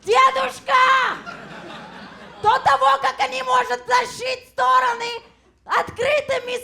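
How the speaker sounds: frantic shouted Russian to grandpa; shouting to grandpa. Retold or imitated. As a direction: yell it across the room in Russian.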